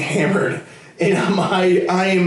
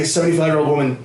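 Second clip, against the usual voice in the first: ordinary talk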